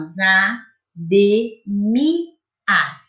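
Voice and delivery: za, de, mi, a said as separate beats; teacher sounding out syllables. Shown as a demonstration. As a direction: space out each syllable clearly. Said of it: "Assim, ó".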